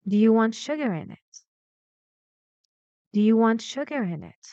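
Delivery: brisk question, pitch moving from "sugar" to the end; quick model question turning on "sugar"; intonation from stressed syllable. Shown as a demonstration. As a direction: peak on "sugar", then fall away through "in it". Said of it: "it goes lower and lower".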